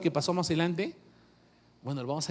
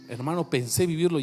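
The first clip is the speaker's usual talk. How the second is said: ordinary talk, nothing marked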